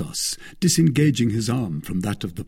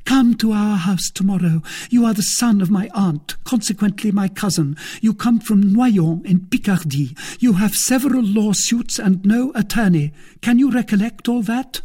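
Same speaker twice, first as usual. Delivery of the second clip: high-pitched, loud female character voice; excited wife; 12 semitones higher, 6 dB louder than usual. Acted. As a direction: rattle off a plot eagerly. Wife gives secret instructions.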